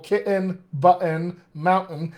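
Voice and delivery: "kitten", "button", "mountain" with a glottal stop for the t, then a vowel; California-style glottal "kitten", "button", "mountain". Shown as a demonstration. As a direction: drop the t to a catch in the throat in "kitten", "button", "mountain". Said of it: "won't do a glottal".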